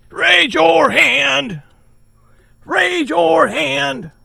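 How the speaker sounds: slow drawn-out instruction; slow command. Shown as a demonstration. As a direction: say it slowly, like a command.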